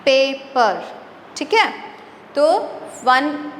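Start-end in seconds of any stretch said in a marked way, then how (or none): 0.0-0.8 "पेपर" in two separate beats, पे and पर; teacher sounding out both syllables of "पेपर"; split into syllables. Shown as a demonstration. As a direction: break "पेपर" after पे. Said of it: "तो दो syllable हो गए".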